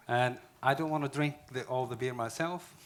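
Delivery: half-shouted joke to the room; loud joking. Real, not acted. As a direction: raise the voice and joke about the beer. Joking loudly to the audience.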